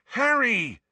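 high-pitched urgent cry; urgent calling. Acted. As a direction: call out to rouse him. Ron calls to wake Harry.

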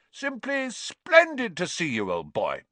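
hammed-up posh gentleman, high; mock pompous toff. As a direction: play the stuffy snob for laughs. Twins mocking Percy's pomp.

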